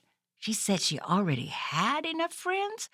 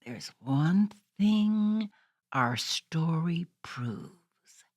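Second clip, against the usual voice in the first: slow, low storybook close; slow story narration; 9 semitones lower, 3 dB quieter, much slower than usual. A narrator's reading. Host closing the storybook for young listeners, slow and low. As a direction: wind the tale down gently.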